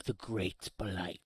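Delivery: a hint of a solemn druid; urgent then hushed and slow. GM voices the druid.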